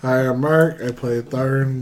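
normal talking voice

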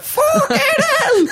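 high, slow, strangled yelp of shock; a squeaky stretched-out shock cry; shocked exclamation. Retold or imitated. Gascoigne's face as Vinnie Jones grabs him, shocked squeal. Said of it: "got this look on his face of".

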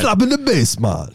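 high opening in a silly mock-Irish accent; botched accent like a cartoon leprechaun. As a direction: play a leprechaun with a jokey Irish lilt. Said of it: "Now you sound like a leprechaun".

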